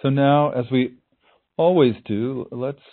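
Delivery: calm, unhurried meditation voice; guided meditation. Acted.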